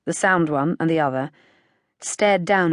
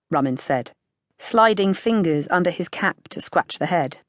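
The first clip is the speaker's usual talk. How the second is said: ordinary delivery, nothing marked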